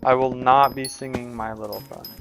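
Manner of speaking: ordinary talk, nothing marked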